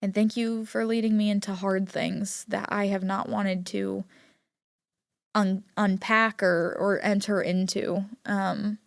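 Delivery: soft spoken prayer. Real, not acted. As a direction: pray softly over them. Prays aloud over listeners.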